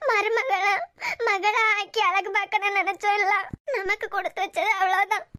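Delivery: tearful grieving lament; a parent weeping for a lost child; crying. Acted. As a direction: grieve through tears, pausing between phrases. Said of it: "இன்னுமா அழுதுட்டு இருக்க".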